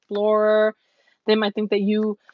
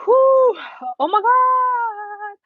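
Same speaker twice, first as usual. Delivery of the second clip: high, loud whoo, then a slow oh my God; excited gush; 12 semitones higher, 5 dB louder, much slower than usual. Real, not acted. Reader swoons over the querent's look, high and loud. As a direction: swoon over their eyes.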